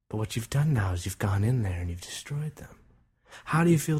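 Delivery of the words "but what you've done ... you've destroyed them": ordinary delivery, nothing marked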